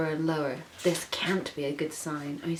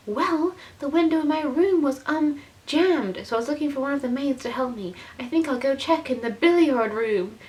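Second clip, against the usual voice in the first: higher, rushed, nervous excuse; flustered excuse; 8 semitones higher, 4 dB louder than usual. Acted. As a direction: blurt out the lie to her father. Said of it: "said Rosa".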